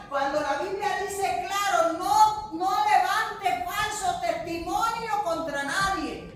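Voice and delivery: loud, high preacher's cry; impassioned sermon delivery; fiery preaching. Real, not acted. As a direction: preach it at full force to the congregation. Preaching at full force.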